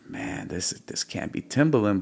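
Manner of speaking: soft, near-whispered doubt; doubtful mutter. Retold or imitated. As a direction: murmur it in disbelief to yourself. Voicing his doubt at the message.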